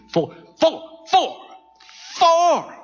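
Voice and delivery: baffled recount climbing to high-pitched disbelief; growing astonishment. Acted.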